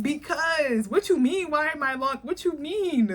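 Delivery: mock indignant demanding client. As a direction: act baffled and offended. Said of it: "you're like".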